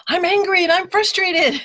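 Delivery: high-pitched mock-frustrated outburst; angry and frustrated. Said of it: "I'd get so angry and frustrated".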